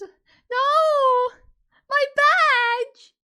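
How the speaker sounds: very high, loud, drawn-out scream; dismayed wail. Real, not acted. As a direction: wail loudly over your stolen badge. Player loses the badge.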